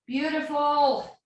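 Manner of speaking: normal talking voice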